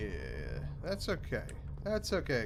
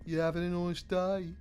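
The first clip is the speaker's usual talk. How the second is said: put-on a light, playful Brummie accent, a bit higher; 5 semitones higher than usual. Greeting a mate called Liam in a Brummie accent. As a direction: chat like a friendly Birmingham local in a Brummie accent. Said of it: "You gotta learn a Brummie accent".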